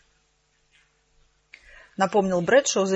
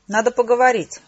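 raised voice, calling outside; called out; 3 semitones higher, 6 dB louder than usual. A narrator's reading. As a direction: call it loudly to someone outside. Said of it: "крикнула я из конторы шерифа".

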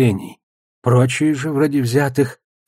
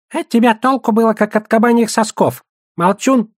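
high-pitched goblin grumbling in outrage; indignant goblin; 11 semitones higher, 4 dB louder than usual. A narrator's reading. Goblin scolding his companion as useless, indignant. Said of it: "возмутился гоблин".

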